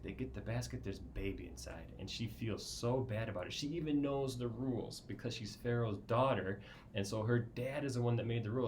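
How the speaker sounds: usual speaking voice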